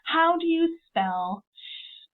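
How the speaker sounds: "How do you spell S?" drawn out slowly; slow teacher question. Shown as a demonstration. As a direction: ask slowly, like to a young class. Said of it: "I would say".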